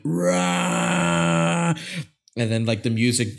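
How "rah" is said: mock battle cry. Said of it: "you see Ash going".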